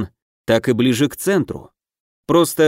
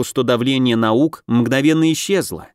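ordinary delivery, nothing marked

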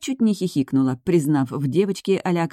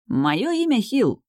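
gallant; 7 semitones higher, much slower than usual. A narrator's reading. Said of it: "галантно поклонился тип".

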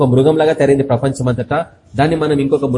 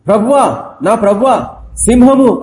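watchman crying out; 5 semitones higher, slower than usual. Retold or imitated. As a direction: call up to the lord in alarm. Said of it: "అని అరుస్తున్నాడు". (ప్రభువుకు అరిచి చెప్పే కేక)

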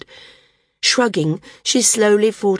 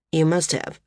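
flat. Acted. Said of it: "he said flatly".

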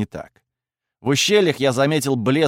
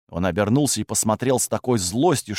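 ordinary delivery

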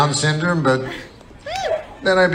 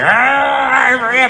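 higher, shouted overwrought reporter voice; mock dramatic journalist; 9 semitones higher, slower than usual. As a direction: ham up a breathless news report. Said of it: "and now it's like".

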